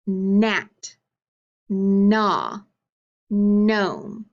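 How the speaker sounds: long held N opening "Gnat", "gnaw" and "gnome"; "Gnat", "gnaw", "gnome" each begun on a drawn-out hummed N; stressed initial sound. Shown as a demonstration. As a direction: stretch the first N of "Gnat", "gnaw", "gnome", with silent G. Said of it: "Listen to the beginning of these words".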